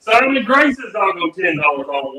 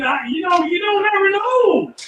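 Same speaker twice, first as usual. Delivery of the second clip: giggly; 10 semitones higher than usual. Real, not acted. Still laughing after the dog reveal.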